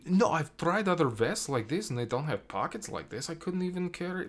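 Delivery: insistent character impression. Film fan imitating a character arguing about vests, lightly insistent impression. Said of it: "she just, like".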